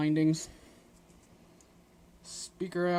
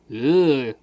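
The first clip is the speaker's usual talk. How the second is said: muffled; 11 dB louder than usual. Real, not acted. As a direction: hand over the microphone. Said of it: "sorry if I sounded real muffled".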